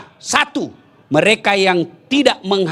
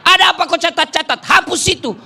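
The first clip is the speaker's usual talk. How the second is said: mock angry husband; 7 semitones higher, faster than usual. Angry husband confronts PLN officer.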